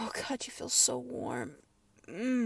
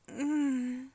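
ordinary talk, nothing marked

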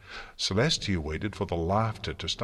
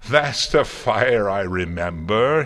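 loud, drawn-out crow of delight; gleeful; 3 semitones higher, 10 dB louder than usual. A narrator's reading. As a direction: crow with glee at provoking her. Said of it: "pirouetted in midair gleefully".

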